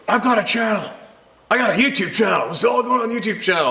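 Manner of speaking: irate canoe guy boasting; mock whiny threat. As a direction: imitate him bragging about his channel. Stranger threatening with channel.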